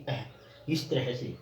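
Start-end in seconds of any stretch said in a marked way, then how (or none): 0.1-0.2 अह with a soft breath of h after the vowel; light h ending. Shown as a demonstration. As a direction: let अह end in a light "h". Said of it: "हल्की है के रूप में आएगी".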